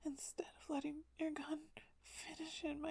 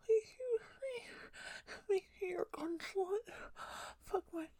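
louder, sobbing begging; desperate crying plea; 2 semitones higher, 4 dB louder than usual. Acted. Pleading climbs into crying.

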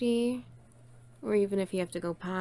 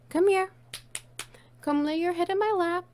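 high, louder sing-song beckon; high coaxing call; 9 semitones higher, 3 dB louder, faster than usual. Acted. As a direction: call the puppy sweetly. Calling the pet over.

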